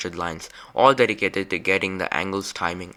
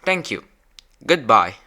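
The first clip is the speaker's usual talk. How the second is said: loud, clear farewell; 9 dB louder than usual. Real, not acted. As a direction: call out goodbye to the robot. Signing off to the robot.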